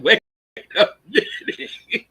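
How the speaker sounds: slow, breathless words through laughter; slowly laughing it out. Real, not acted. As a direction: squeeze it out between laughs. Laughing at the car scene.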